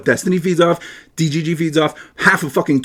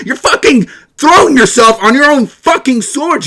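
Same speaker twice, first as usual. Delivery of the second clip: ordinary delivery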